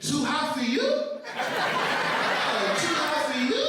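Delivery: high-pitched, teasing question; put-on high voice for the salon lady; mock salon lady. Nail salon lady challenging a customer over hot water, mocking high voice.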